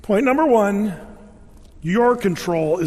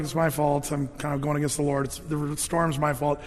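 sheepish confession; 5 semitones lower, 6 dB quieter than usual. Retold or imitated. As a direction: lower and softer, a guilty admission. Jonah confessing to sailors.

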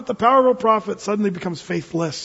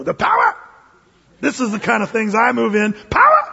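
hammed-up excitement; 3 semitones higher, 4 dB louder than usual. Preacher acting Elijah loving the mighty wind, hammed-up excitement. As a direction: play a thrilled prophet, voice leaping way up. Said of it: "He's all excited".

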